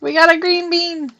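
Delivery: high, excited exclamation. Real, not acted. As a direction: cheer over the find. Excited at finding a bean.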